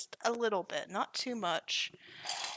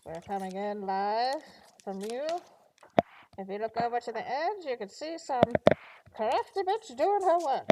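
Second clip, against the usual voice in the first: lightly done pilot announcement through a mask; a hint of an airline pilot on the intercom; 3 semitones higher, 5 dB louder than usual. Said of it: "probably sound a little bit like your airline pilots".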